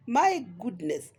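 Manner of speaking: ordinary talk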